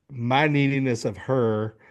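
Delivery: usual speaking voice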